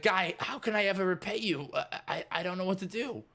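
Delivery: high, breathless gratitude; lost for how to thank him; gushing Scooter. Acted. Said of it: "he's like".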